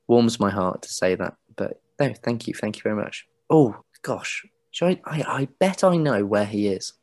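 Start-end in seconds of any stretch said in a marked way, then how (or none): none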